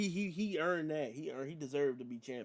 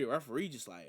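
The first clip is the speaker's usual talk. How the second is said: usual speaking voice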